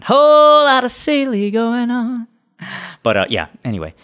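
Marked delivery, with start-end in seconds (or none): 0.0-2.3 high, slow, put-on voice repeating the phrase; sing-song high echo. Host echoing his own line for fun, high silly voice.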